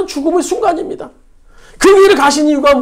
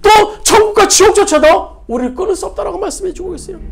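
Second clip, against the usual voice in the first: fervent preaching; 5 semitones higher than usual. Real, not acted. Preaching at full force.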